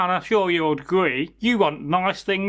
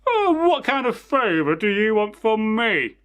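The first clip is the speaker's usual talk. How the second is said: cat voice; 4 semitones higher than usual. Acted. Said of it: "the cat ... says".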